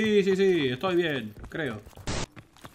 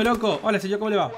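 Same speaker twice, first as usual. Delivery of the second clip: ordinary delivery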